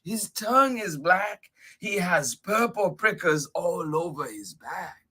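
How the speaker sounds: high, lively storybook reading; animated story narration. A narrator's reading. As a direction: describe the monster with mounting wonder. Excited narration of the monster's description.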